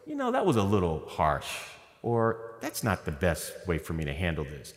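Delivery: quiet backpedalling parent. Retold or imitated. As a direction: ease off, a little sheepish. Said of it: "then you go".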